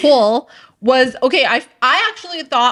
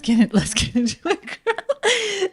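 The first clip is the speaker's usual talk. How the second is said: giggling through the words; laughing; 8 semitones higher, 5 dB quieter than usual. Real, not acted. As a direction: laugh it out, voice lifting at the end. Giggly wrap-up to start the topic.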